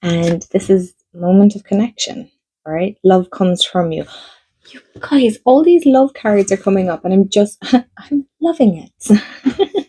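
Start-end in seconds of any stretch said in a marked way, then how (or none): 4.7-9.9 much higher, bubbling with laughter; giddy excitement. Real, not acted. Telling viewers the love cards are coming, giddy and laughing. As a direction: gush to your viewers, laughing with delight.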